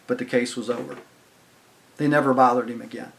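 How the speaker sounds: normal talking voice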